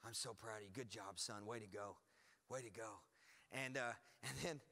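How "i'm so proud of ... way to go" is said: low, soft, mildly choked-up praise; quietly moved father, lightly imitated; imitating tearful dad. Proud dad hugs his preacher son, imitated tearful praise. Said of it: "He's cried, said".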